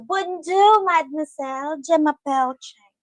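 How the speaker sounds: put-on playful French accent, high on bonjour; a lightly put-on French accent from a cartoon. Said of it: "If you know Madeline Conte".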